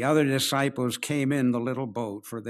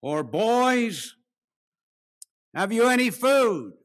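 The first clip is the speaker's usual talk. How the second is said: higher, louder call across the water; 9 semitones higher, 7 dB louder, slower than usual. Acted. Jesus calls from the shore to the disciples, calling out loud. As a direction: shout it out to men in a boat.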